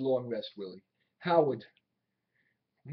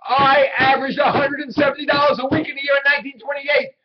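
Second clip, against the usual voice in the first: "I averaged a hundred and seventy dollars" shouted, much higher and louder, hitting "dollars" hardest; indignant shout; 10 semitones higher, 11 dB louder than usual. Acted. Willie insisting to his doubting boss Howard, shouting. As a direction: bellow it back at him to force him to believe it. Repeats it after being contradicted.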